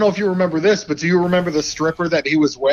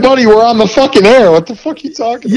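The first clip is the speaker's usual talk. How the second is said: higher, louder imitation of an incredulous host; mock exasperated rebuke; 7 semitones higher, 7 dB louder than usual. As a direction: act out scolding a caller live on air. Radio host's retold rebuke, acted.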